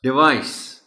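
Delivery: firm model "डिवाइस"; corrected word. Shown as a demonstration. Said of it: "नहीं है ये लफ्ज".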